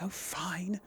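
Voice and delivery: usual speaking voice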